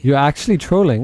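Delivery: ordinary talk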